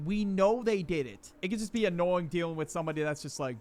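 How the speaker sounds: usual speaking voice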